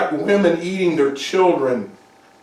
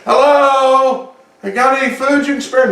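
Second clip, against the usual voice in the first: calling out; 7 semitones higher than usual. Acted. Lepers calling into the camp.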